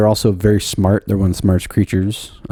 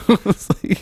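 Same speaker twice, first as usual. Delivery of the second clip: laughing; 12 semitones higher than usual. Real, not acted. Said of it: "I got really confused".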